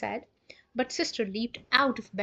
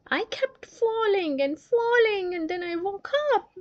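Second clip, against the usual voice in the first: high, teary, whimpering recount; childish high voice close to tears; crying girl; 10 semitones higher, 4 dB louder than usual. Acted. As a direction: tell the nightmare through sobs. Sister retells nightmare, crying.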